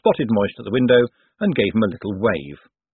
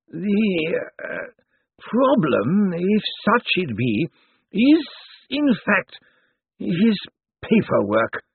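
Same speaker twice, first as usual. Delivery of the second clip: higher, slow, dusty legal voice; dry old zombie lawyer; 11 semitones higher, much slower than usual. Acted. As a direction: ancient lawyer weighing every word. Slant reaches the point.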